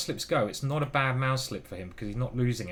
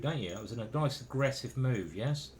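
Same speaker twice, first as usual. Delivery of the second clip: ordinary talk, nothing marked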